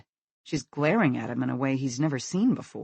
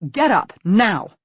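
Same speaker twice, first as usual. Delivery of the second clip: loud, slower firm command; 6 semitones higher, 10 dB louder, slower than usual. Acted. Mother commands her son out of bed, loud and firm. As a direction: order him out of bed. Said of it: "his mother commands".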